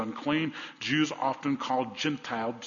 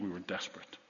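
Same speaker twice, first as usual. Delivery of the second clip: hushed repeat; 6 semitones lower, 13 dB quieter, faster than usual. Acted. Quiet repeat.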